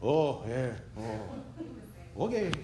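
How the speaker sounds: a hint of a meek Frenchman caving in; mock French giving up. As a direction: shrug it off like a nation surrendering. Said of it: "they're like".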